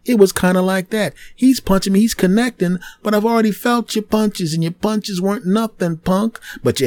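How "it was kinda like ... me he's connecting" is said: usual speaking voice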